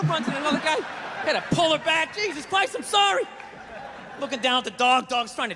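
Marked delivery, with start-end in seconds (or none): 2.1-3.2 flustered apology. Retold or imitated. Apologizing while pulling dog back.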